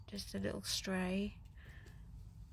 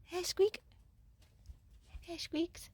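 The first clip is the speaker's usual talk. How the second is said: cooing to the cat; 9 semitones higher than usual. Real, not acted. Greeting the cat Meepy, high cooing baby talk.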